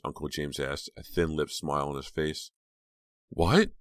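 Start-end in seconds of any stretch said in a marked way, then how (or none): 3.3-3.7 high, loud startled question. A narrator's reading. Girl caught off guard by uncle's question, startled. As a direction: shoot the pitch up, caught off guard. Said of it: "Her question startled her".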